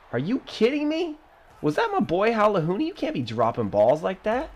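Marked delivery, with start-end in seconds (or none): 0.1-3.2 disbelief. Real, not acted. Gamer yells at his receiver in shocked disbelief. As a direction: voice jumps high in disbelief. Teammate drops a pass.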